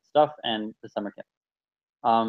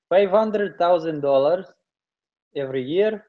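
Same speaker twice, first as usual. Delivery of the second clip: put-on a half-hearted Russian accent that is soon dropped; 6 semitones higher, 3 dB louder than usual. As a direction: drag out the numbers in a mock Russian accent. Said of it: "Okay, I'm not gonna do this".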